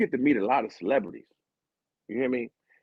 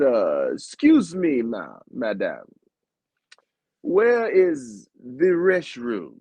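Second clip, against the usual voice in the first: put-on French accent; much slower than usual. Tourist asking a madame in English, mock French accent. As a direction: ask in a hammed-up French accent. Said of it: "in my little French, um, accent".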